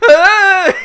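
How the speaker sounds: loud, high, drawn-out scream; mock scream. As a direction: scream like falling out of a chair. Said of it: "falling out of his chair and screaming".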